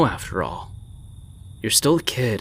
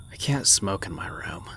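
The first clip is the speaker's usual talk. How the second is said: whispered aside, much softer; hushed whisper; 3 semitones lower, 7 dB quieter than usual. Acted. Uncle sneaking a smoke on the balcony, whispering.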